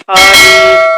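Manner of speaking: الف said loud with a long drawn-out "aa" at the start; exaggerated over-long الف; wrongly lengthened. Shown as a demonstration. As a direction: drag out the first vowel of الف. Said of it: "لمبا کر دیتے ہیں".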